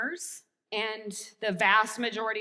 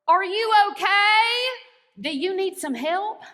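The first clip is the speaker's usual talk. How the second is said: put-on Chinese village accent, pitched up and louder; 10 semitones higher, 10 dB louder than usual. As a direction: ask kindly in a Chinese village accent, raised and bright. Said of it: "ask you in the local village accent".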